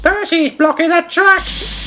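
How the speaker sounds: high, slow whiny complaint; James moaning. A narrator's reading. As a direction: drag it out in a whine. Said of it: "moaned James".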